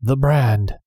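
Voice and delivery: breathy shocked gasp of words; a touch of horror; mild horrified gasp. A narrator's reading. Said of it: "D'Artagnan gasped".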